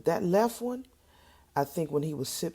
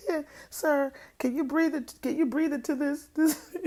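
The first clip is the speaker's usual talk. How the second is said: much higher, prissy polite reporter voice; mock reporter question; 12 semitones higher than usual. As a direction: play a fussy reporter asking politely. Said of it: "Would you say".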